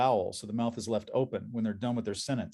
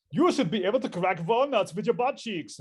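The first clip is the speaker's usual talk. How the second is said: put-on much higher Austrian bodybuilder accent; Hans and Franz; 8 semitones higher, 3 dB louder than usual. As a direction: pump it up in a mock Austrian strongman accent. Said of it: "I'm talking like Hans and Franz".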